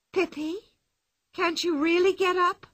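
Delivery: put-on anxious girl calling into the tree; worried question. A narrator's reading. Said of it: "she asked".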